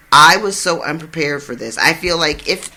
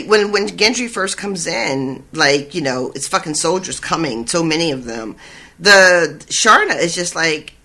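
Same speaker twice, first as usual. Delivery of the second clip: ordinary talk, nothing marked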